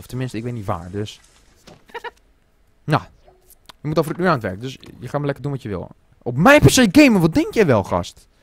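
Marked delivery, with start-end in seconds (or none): 6.2-8.3 loud, high-pitched outrage; how dare you, yelled in indignation; indignant outburst. Real, not acted. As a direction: scold loudly, pitch shooting way up. Outraged at character using their PC.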